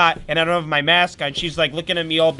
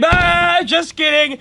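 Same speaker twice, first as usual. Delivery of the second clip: just kidding; 9 semitones higher, 4 dB louder, slower than usual. Retold or imitated. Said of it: "and I say".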